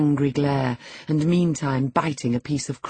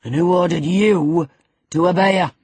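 loud, higher, accusing; raised-voice interrogation; angry demand; 4 semitones higher, 7 dB louder than usual. A narrator's reading. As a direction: demand it loudly of an underling. Master angrily questions Hareton.

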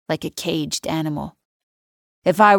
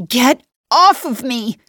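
high slow desperate scream; 7 semitones higher, 6 dB louder, slower than usual. A narrator's reading. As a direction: scream it in terror. Girl screaming at attacker.

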